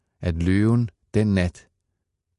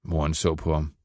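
ordinary delivery, nothing marked

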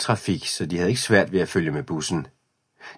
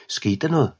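higher worried call from behind; 6 semitones higher than usual. A narrator's reading. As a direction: call ahead to check on him. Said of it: "råbte Camille". (bekymret råb)

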